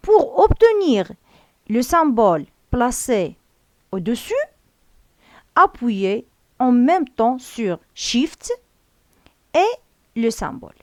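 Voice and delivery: slow, deliberate instruction reading; slow dictation read. Acted. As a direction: read each French word out slowly and clearly, like dictating to a class. (قراءة تعليمية بطيئة ومتأنية)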